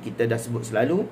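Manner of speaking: ordinary delivery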